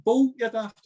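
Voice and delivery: high, loud retort at the robber; mock shout. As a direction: bark it at the stranger. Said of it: "He's going".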